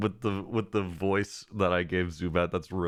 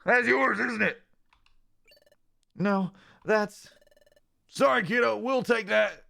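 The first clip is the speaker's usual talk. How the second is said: raised, loud mocking taunt; snatch it away with a smirk; sneering bully; 14 semitones higher, 4 dB louder than usual. Bully Pokemon stealing the partner's treasure, loud and mocking.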